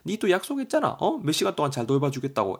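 high-pitched indignant scolding; mock-berate them for a broken promise; puppy owner reproaches a friend, indignant; acted anger. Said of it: "이렇게 막 화를 내는 거죠".

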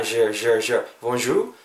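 "je" repeated with a buzzing French J; soft ZH sound. Shown as a demonstration. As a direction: draw the soft J of "bonjour" into "je". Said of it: "이런 발음으로 해주셨으면 좋겠습니다". ("je", "bonjour"의 부드러운 프랑스어 j 소리)

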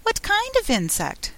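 high-pitched, slightly anxious question; a little anxious. A narrator's reading. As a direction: ask it with a touch of worry, pitched high. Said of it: "Alice inquired a little anxiously".

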